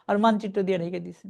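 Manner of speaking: normal talking voice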